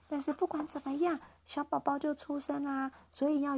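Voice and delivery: put-on reading to a toddler. Storybook line to toddler.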